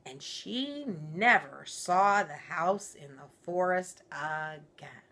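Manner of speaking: ordinary delivery, nothing marked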